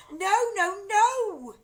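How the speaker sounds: very high loud no; crying out. A narrator's reading. Family crying out to stop grandma. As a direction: cry it out in alarm. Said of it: "the other two cried".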